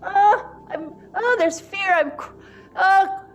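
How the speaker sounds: high, loud acted panic; fearful crying. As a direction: wail like a frightened infant begging to be held. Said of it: "fearful. I'm crying".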